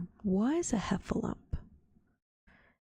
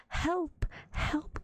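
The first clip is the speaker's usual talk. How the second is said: high, panicked cry for help; a small scared animal crying out; frightened cry; 7 semitones higher than usual. A narrator's reading. Piglet crying for help, terrified at the trap. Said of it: "cried Piglet".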